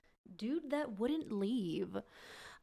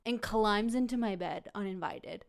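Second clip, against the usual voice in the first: ordinary talk, nothing marked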